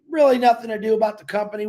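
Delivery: ordinary talk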